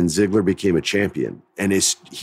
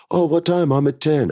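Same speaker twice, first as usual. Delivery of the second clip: mock chatty actor; 7 semitones higher than usual. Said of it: "you hear actors talk about".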